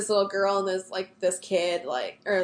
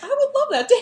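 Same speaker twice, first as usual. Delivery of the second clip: gushing; 6 dB louder than usual. Retold or imitated. Gushing over snow globe date.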